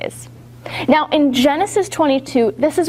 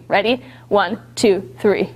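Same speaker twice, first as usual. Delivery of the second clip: usual speaking voice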